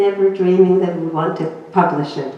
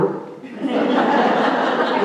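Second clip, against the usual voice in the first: breathy laugh; 4 semitones higher than usual. Real, not acted. Laughing at husband's blunt no.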